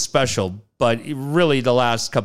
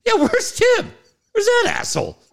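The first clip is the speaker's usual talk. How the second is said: mock furious. Angry mock search.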